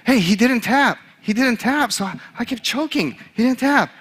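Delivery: high-pitched, frightened protest; acted-out scared defensiveness. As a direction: plead your excuse in a panicky high voice. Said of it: "you could tell, was scared".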